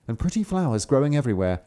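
ordinary delivery, nothing marked

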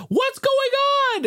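very high squeaky imitation of the wife; wife asking. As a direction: ask it way up high, mock-alarmed. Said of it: "And then Susan".

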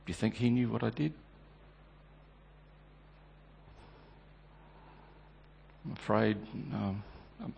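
soft, hushed, broken voice; quiet despair. Acted. Peter alone after the denials, quiet despair. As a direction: speak as if barely holding together.

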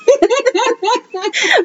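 real high rising laugh; amused embarrassed laugh; high giggle. Real, not acted. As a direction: burst into a giggle at your own confession. Laughing at her own story.

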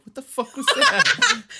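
high loud startled yell; startled shout. Retold or imitated. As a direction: shout it out in shock, laughing. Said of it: "And I'm like".

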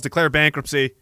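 usual speaking voice

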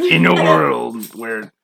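slow dramatic trailer narrator; drawn-out epic announcer opening; movie trailer voice. Pretend.

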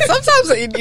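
words squeezed out high through a laugh; real laughter. Real, not acted. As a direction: laugh as you speak. Laughing at blunt friend.

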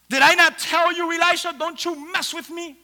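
high, wounded reproach; acted anguished challenge; pained confrontation. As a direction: confront the prophet with a mother's hurt. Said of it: "you could feel her pain".

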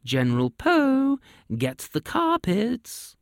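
high, slow summoning call; king calling across the inn, slow and high. A narrator's reading. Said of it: "called out".

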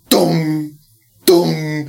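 brick clanging sound. Retold or imitated. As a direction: voice a ball clanking off the rim. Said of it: "like, thunderous sound".

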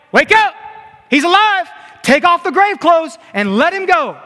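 high, loud urgent command; exasperated shout. Acted. Preacher as Jesus to frozen onlookers, exasperated shout. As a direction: jolt stunned bystanders into action.

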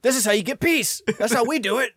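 high, loud self-justifying crowing; hammed-up high-pitched lecture on peacemaking; mock warmonger. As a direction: proclaim it proudly in a silly high voice. Acts out US justifying war.

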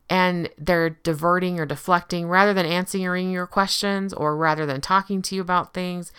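ordinary talk

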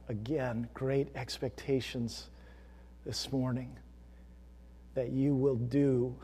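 soft, measured pastoral prayer. Real, not acted. Pastor praying for the congregation before the sermon, hushed prayer. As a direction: pray quietly and reverently, with long pauses.

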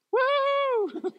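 very high, loud whoop of joy; celebratory whoop. Real, not acted. Pastor celebrating the church surviving, joyful whoop. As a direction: cheer the victory.

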